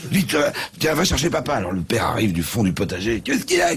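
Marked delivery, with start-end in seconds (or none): 0.1-1.5 higher, hurried mother; urgent mother. Acted. Mother sends for father. 3.2-3.8 very high worried question; alarmed father. Acted. Father arrives, asks.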